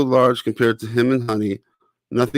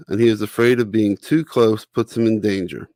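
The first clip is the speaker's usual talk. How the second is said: ordinary delivery, nothing marked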